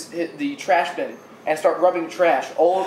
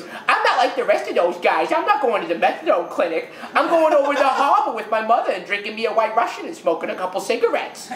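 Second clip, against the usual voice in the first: imitating Bullethead; 4 semitones higher than usual. Ferry woman setting herself apart from the others, in character. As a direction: play the indignant, boastful beggar woman. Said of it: "she talks like this all the time".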